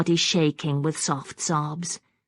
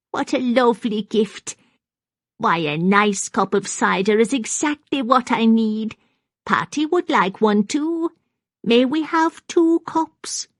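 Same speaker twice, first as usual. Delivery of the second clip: put-on high-pitched, pleased old lady; delighted old woman; 6 semitones higher, 4 dB louder than usual. A narrator's reading. Maggie accepts cider for herself and doll, delighted high voice. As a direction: climb higher with delight, ending in a hopeful request. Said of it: "now a wreath of smiles".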